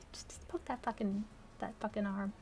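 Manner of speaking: soft hushed whisper; whispered aside. Real, not acted. Mocking her character's flirting, whispering aside. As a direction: say it under your breath.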